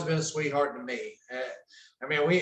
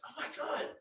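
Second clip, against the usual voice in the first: hushed, breathy gasp at the window; startled whispered oh my God; 10 semitones higher, 14 dB quieter, faster than usual. Retold or imitated. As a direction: whisper it so nobody hears. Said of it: "and, and she goes".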